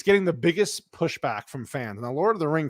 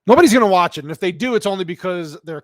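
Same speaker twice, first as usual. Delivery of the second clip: loud rant; 3 semitones higher, 8 dB louder, faster than usual. Real, not acted. Angry rant outburst.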